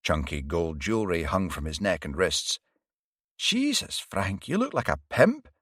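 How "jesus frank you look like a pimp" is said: high, sudden blurt of shock. A narrator's reading. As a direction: let it burst out before she can stop it. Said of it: "Varry blurted out".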